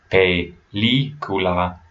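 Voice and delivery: slow "película", every vowel given full value; syllable-by-syllable "película" with no schwa; full, unreduced vowels. Shown as a demonstration. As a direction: draw out "película" as peh-LEE-koo-lah. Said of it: "I do not change the pe".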